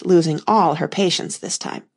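ordinary delivery, nothing marked

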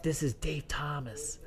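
slow hushed whisper. Retold or imitated. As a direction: whisper it like a secret. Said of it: "this guy whispering".

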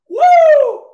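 a huge, very high and loud woo; joyful whoop. Real, not acted. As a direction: let out a real party yell. Celebrating after the fight talk.

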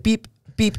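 high electronic button beeps; imitated camera beeps. Imitating the camera as buddy deletes the photo, high beeps.

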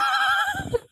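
squealing laugh, way up high. Real, not acted. Laugh after joke.

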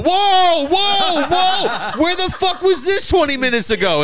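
mock astonishment. Friend on the stairs shocked by Gabe, very high. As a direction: squeal in comic disbelief. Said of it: "and I go".

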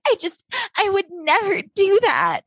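high, teary imitation of Rachel; a high put-on weepy voice mimicking her; mock crying. Imitating a girl crying over the boy. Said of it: "she starts crying".

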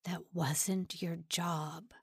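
low, soft, slow mutter. A narrator's reading. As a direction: mumble it down at the floor. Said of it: "Simon muttered to the floor".

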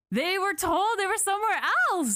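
high, pushed indignation; outraged. Real, not acted. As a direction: sound fed up with the cover-up. Outraged rant about the report.

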